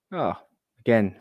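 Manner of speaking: ordinary talk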